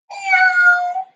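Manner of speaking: high cat meow. Shown as a demonstration. Teacher leading kids' yoga, a high cat meow. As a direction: meow like a kitten for the kids. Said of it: "I wanna hear those meows".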